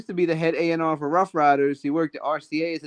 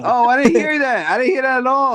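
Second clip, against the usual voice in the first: high, loud surprise; surprised; 7 semitones higher, 5 dB louder than usual. Real, not acted. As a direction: caught off guard, louder. Surprised he missed it.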